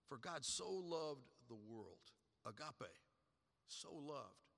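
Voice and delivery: slowed right down, dwelling on loved; slow, savoured repeat. Shown as a demonstration. Preacher repeating the verse to the church, slow and hushed. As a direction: let each word land slowly.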